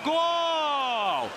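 high shouted goal call. Real, not acted. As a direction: yell the goal out. Commentator calls a goal.

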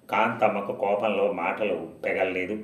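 plain reading voice

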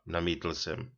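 usual speaking voice

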